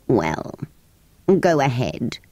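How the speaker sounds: low, reluctant creature voice; resigned creature. Acted. Creature agreeing to grant wishes, low and resigned. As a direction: give in low and wearily.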